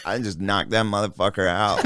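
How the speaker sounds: lightly imitated low, offhand fighter; halting, understated impression, low and soft; Nate Diaz. Fighter shrugging off a knockout to interviewer, lightly imitated in low voice. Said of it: "I'll do the Nate Diaz".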